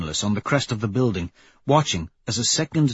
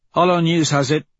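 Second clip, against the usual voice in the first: higher, louder squad member's report; Pebble, crew news report; 7 semitones higher, 5 dB louder than usual. Acted. Pebble tells the crew what Holo News says, reporting. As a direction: announce it to the crew in a raised voice.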